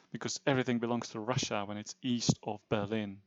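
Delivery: ordinary talk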